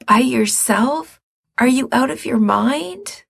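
can't believe he'd go alone; alarmed disbelief. Acted. Mikey hears Gerard will go alone, alarmed.

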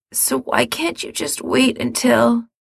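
pleading younger brother. A narrator's reading. Mikey pleading.

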